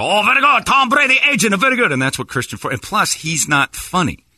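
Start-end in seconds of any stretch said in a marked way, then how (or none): 0.0-1.9 high, loud mock Chinese accent; exaggerated Chinese-accent caricature, pitched way up; Don Yi. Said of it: "You can't do the Chinese accent".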